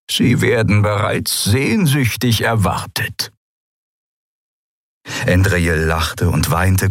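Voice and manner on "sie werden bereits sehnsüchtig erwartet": slow, lightly voiced old man; old Skria. Old Suran gladly greets his old friend. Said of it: "Der alte Mann lächelte".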